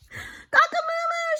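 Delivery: high loud alarm crow; rooster crow gone wrong. Acted. Rooster crowing to scare off the fox. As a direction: crow out to wake the farm. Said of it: "shouted Rooster".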